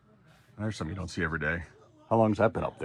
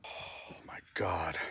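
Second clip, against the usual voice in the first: slow, shaken whisper; whispered relief after a scare; 11 dB quieter, much slower than usual. Real, not acted. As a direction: let it out barely voiced.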